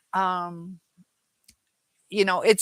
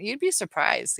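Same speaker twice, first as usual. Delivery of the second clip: ordinary delivery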